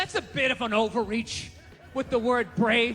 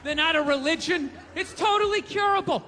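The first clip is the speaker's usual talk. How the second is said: indignant rant; 6 semitones higher, 9 dB louder than usual. Acted. Comic ranting, loud laughing crowd.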